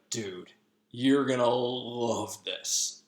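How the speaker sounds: slow, drawn-out teasing; savouring the news slowly; sly Puck. Retold or imitated.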